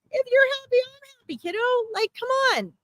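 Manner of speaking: very high, light dad impression; imitated Italian dad. Medium acts Liz's exuberant dad reassuring her, high imitated voice. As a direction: toss it up high, arms-in-the-air insistence. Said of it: "giving me a big Italian vibe here".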